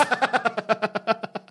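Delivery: laugh. Real, not acted. Laughs after joke ad.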